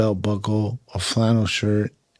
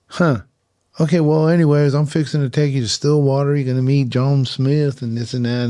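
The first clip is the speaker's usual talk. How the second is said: higher, louder Oklahoma cowboy voice; drawling cowboy; 5 semitones higher, 4 dB louder than usual. Retold or imitated. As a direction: play the tobacco-chewing Oklahoma local. Oklahoma equipment manager voiced in story.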